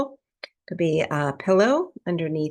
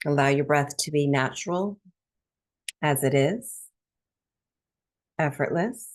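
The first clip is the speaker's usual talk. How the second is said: calm, measured breathwork instruction; guided meditation. Real, not acted. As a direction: ease listeners into stillness, phrase by spacious phrase. Leads breathwork meditation after intro.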